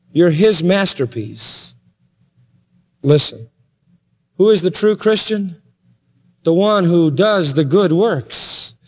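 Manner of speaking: usual speaking voice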